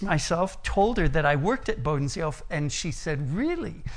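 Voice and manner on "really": high surprised "Really?" on a falling tune. Retold or imitated. The neighbor woman, surprised to hear he works there, high surprised question. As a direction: pitch jumps up in mock surprise.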